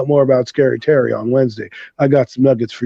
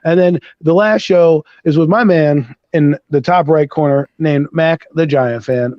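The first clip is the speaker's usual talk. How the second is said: ordinary delivery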